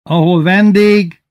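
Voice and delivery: high, loud, slow call; cheerful shout. A narrator's reading. Old farmer answering that guests bring peace, cheerful shout. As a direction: shout it merrily from the cart. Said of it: "kiáltott az öreg vidáman".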